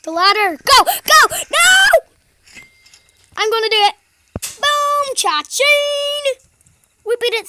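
high, loud triumphant yells; excited shouting. Real, not acted. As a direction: cheer as you escape. Racing to escape, then winning.

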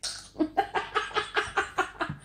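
high, rapid breathy giggle; long staccato laugh; giggling fit. Real, not acted. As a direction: dissolve into helpless high giggles. Cracks up after getting dirty.

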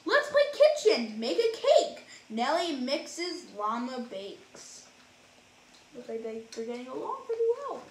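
lively, sing-song storybook reading; reading to children. A narrator's reading. Reading a rhyming picture book to kids, animated. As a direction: read it like bedtime for little ones.

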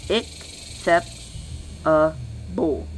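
ACCEPTABLE split into slow, separate syllables. Shown as a demonstration. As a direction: say acceptable very slowly, pausing between each syllable. Same word split into syllables.

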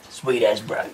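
low Kiwi Boba Fett drawl; broad kiwi accent. Pretend. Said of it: "bloody Boba Fett going".

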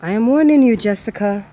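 loud, sharp warning. Acted. Woman warning her rival Jessica, loud and firm. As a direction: warn her off, loudly. Quarrel between two women.

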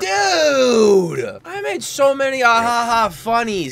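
high, loud whine; exasperated shout. Real, not acted. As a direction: cry out in disbelief. Mic was muted.

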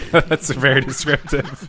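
amused, slow, laughing. Real, not acted. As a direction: say it through laughter. Laughing reply.

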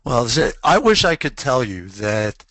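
usual speaking voice

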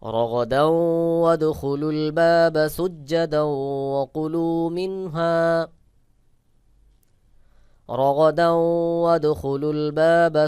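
very slow, high melodic recitation; Quran recitation. Shown as a demonstration. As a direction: chant the verse, stretching each vowel. Full verse recitation at close. (ধীর সুরেলা কুরআন তেলাওয়াত)

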